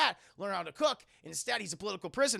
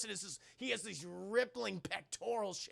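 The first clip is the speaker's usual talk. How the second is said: normal talking voice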